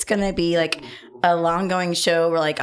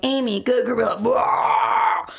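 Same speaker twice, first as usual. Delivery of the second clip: higher, shouted, cry-like gorilla bellow; mock gorilla rage; 4 semitones higher, slower than usual. Woman acting her angry drunk self as a gorilla, mock shout. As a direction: roar like the Congo gorilla. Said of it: "flip over tables like Amy from Congo".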